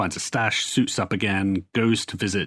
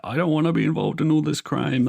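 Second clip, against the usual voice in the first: higher, nervous mock whine; a mildly panicked plea to stay out of it; 7 semitones higher than usual. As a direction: play the jittery coward backing out. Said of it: "all nervous and shit".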